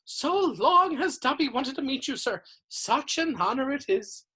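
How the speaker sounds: high-pitched reverent house elf; adoring house elf. A narrator's reading. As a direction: gush like a devoted little servant. Dobby's adoring greeting.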